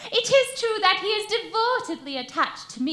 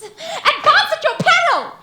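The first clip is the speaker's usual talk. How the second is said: much higher and louder, laughing through it; hammed-up shrill warning; mock alarm; 10 semitones higher, 9 dB louder than usual. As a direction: squeal it as a hammed-up maiden.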